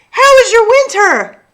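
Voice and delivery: put-on high, loud, overjoyed kid voice; delighted child. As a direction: greet her old friend with glee. Sophie greets sprout happily.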